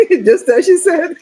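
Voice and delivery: high, loud words through laughter; laughing. Real, not acted. Host amused as the child calls mom mid-talk, laughing.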